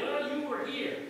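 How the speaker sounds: usual speaking voice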